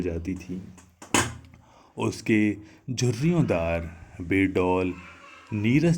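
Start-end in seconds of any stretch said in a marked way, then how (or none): none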